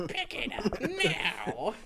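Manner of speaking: high, slow, laughing teacher scolding; mock teacher scold. Friend mimics teacher scolding kids over trash, slow and high. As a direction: ham up the teacher's order.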